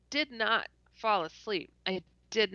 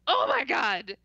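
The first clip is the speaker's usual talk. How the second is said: higher, louder inner exclamation; retold astonishment; 4 semitones higher, 5 dB louder than usual. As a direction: act out a stunned oh my God. Retold panicked thought on meeting Jesus.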